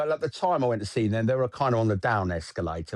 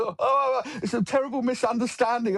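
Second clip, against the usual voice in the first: squeaky, much higher panicked blurt; 11 semitones higher than usual. Retold or imitated. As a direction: gasp it out in terrified protest. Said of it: "sort of blurted out".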